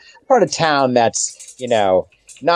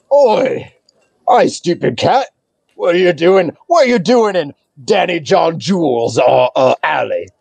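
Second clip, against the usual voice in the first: raised, accosting voice from the rubbish pile; alley thug. Acted. Unseen thug confronts party in his alley, accosting. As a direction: challenge the intruders as a rough alley thug. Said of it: "you just hear this voice say".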